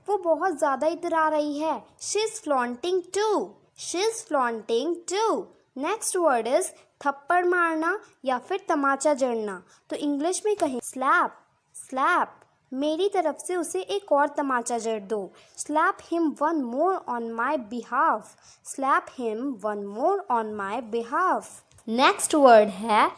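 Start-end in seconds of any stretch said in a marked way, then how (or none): 2.0-5.3 slow, careful teacher read of each word; slow model sentence. Shown as a demonstration. Teacher reading an example to Hindi learners, slow and clear. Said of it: "teacher models example slowly". 15.6-21.5 slow, careful teacher read of each word; slow model sentence. Shown as a demonstration. Teacher reading an example to Hindi learners, slow and clear. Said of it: "teacher models example slowly".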